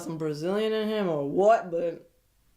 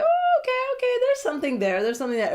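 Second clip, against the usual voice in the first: mock surprised delight; 3 dB louder, faster than usual. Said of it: "Their chemistry was all like".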